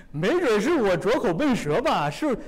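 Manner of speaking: a self-doubting Moses, half laughing then pleading; frustrated Moses. Acted. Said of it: "摩西说".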